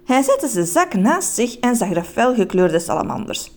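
ordinary delivery

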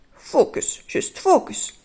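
urgent whispered focus; whispering to himself to focus; whispered self-command. Acted. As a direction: hiss a reminder to yourself. Urging himself to concentrate.

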